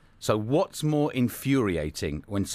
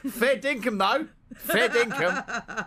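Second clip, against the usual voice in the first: high, hammed-up Aussie accent; mock Australian; 12 semitones higher, slower than usual. Radio host hamming up an Aussie accent for April Fool's. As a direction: ham up an Aussie drawl. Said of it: "your accent is kind of slipping off Australian".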